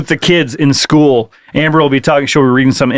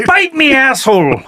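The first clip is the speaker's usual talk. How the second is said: hammed-up Irish pirate growl, pitched up; a kinky Irish pirate, tossed off for a laugh; 7 semitones higher than usual. Joking about commas, a mock Irish pirate voice. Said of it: "That was like an Irishman".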